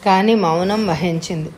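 ordinary delivery

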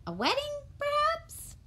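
high, quick shop assistant; a polite high-voiced shopkeeper. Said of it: "said the shop assistant".

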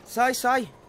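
urgent shouting. Real, not acted. Urging cars out of the way.

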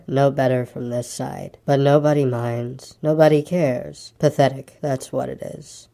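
low, slow, dejected donkey; gloomy Eeyore. Acted. Eeyore regards his reflection, low and gloomy. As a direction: drag the words out low and mournful.

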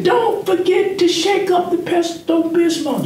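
high mock wife voice; way up high, imitating his wife; wife's reminder. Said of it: "She said".

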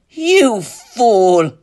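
high, loud enchantress cry; drawn-out, shouted taunt; scornful cry. A narrator's reading. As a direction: cry it out in triumph. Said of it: "cried the Green Enchantress".

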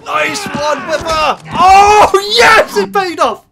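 high, loud shouts of triumph; elated cheering. Real, not acted. Cheering on Biffa as the plan pays off, elated. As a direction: yell it out in jubilation. Cheering film hero's success.

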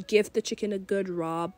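normal talking voice

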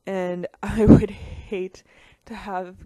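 laughing through the words. Real, not acted. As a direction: giggle while saying it. Laughing mid-sentence about colors.

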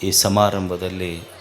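low, soft, measured salutation in short phrases; hushed, level-pitched formal opening prayer; solemn invocation. Real, not acted. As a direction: open reverently, low and quiet, with long pauses. Opens discourse with invocation and salutation.

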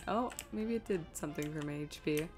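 ordinary talk

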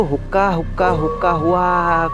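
narrator's hooting jackal cry; jackal howl. A narrator's reading. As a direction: imitate jackals howling in the night. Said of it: "করে ডাকছে".

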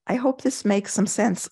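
normal talking voice

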